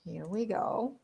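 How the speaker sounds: usual speaking voice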